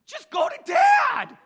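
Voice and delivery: hammed-up big-brother begging shout; pleading brothers. Brothers begging the stubborn boy, hammed-up high shout. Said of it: "They're like".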